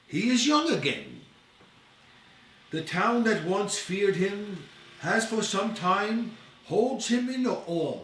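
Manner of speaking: ordinary delivery, nothing marked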